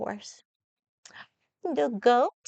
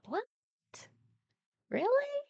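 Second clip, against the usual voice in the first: mock surprise; 14 semitones higher than usual. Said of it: "You thought".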